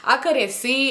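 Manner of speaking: usual speaking voice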